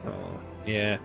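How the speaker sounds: ordinary talk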